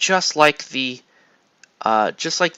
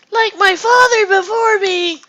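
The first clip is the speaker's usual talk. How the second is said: much higher, loud, crying out the line; hammed-up crying shout; 6 dB louder than usual. [name] hams up Luke's line as an anguished crying shout. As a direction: cry it out like a melodramatic movie hero.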